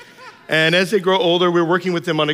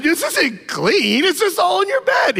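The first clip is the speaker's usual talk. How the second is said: laughing scold; 3 dB louder, slower than usual. Retold or imitated. Dad scolds while laughing.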